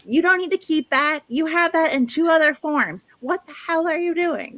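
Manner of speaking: high-pitched mock-bickering outburst; mock yelling. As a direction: scold a friend in a pretend yelling match. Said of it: "listen to us yell over".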